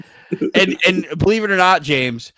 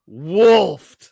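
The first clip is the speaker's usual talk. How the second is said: emphatic repeat; 3 semitones higher, 7 dB louder than usual. Real, not acted. Repeating his own odd word.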